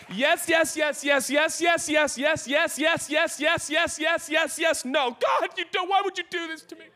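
loud chanted yeses tipping into aggrieved whining; mock complaint. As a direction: hammer out the yeses, then plead in mock outrage. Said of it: "That's a lot of Christians".

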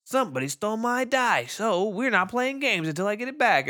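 high whining imitation of the teacher; whiny teacher voice. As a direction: squeal it like a sulking teacher.

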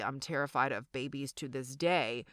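normal talking voice

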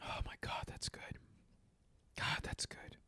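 breathy whisper after a sip. Real, not acted. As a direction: whisper in bliss after drinking. After sip of iced coffee.

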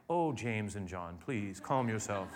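a mild tender plea; gentle calming. Retold or imitated. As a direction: coax them calmly, the way it wasn't. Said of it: "it wasn't like".

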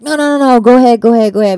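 eager encouragement; urging. Retold or imitated. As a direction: wave him off, go go. Helper urging him to go.

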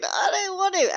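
high, whiny protest; mock reluctance. Mum at the edge of a freezing sea, whining. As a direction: whine it like a reluctant swimmer.

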